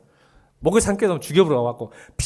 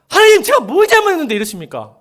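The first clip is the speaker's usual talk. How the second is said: higher, loud angry prayer; crying out to God; 10 semitones higher, 7 dB louder than usual. Retold or imitated. Worshipper yelling at God over unfair trouble, loud and high. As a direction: shout the complaint at God. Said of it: "소리 지르시라니까요".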